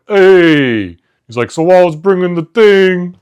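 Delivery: two workers bonding, hammy Jersey talk; New Jersey worker accent. Retold or imitated. Supermarket worker greeting his buddy, loud Jersey accent. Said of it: "bonded over their accent".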